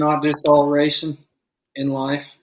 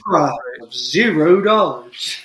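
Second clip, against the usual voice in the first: slow, big game show host voice; booming prize announcer; 6 dB louder than usual. Pretend. Mock game show prize reveal.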